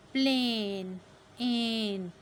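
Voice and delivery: प्लेन, then एन, loud and drawn out; long A. Shown as a demonstration. Teacher models a long vowel, stretched प्लेन. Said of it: "long है".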